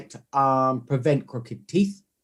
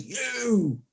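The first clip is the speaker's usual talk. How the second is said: raised, accusing mock outburst; higher-pitched retold heckle; rude confrontation; 6 semitones higher, slower than usual. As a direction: imitate a rude man challenging you. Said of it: "he gives me some, you know, some rudeness".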